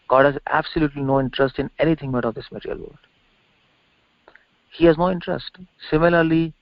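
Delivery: ordinary talk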